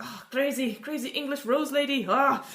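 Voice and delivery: high, hammed-up French accent; panicked Frenchman in a French accent. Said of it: "doing French accents tonight".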